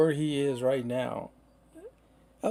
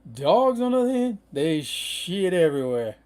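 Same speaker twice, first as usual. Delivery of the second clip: higher and louder, building up to the punchline; emphatic contrast; 5 semitones higher, 4 dB louder than usual. Real, not acted. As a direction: lift it up big for the contrast. Contrasting dogs with easy cats.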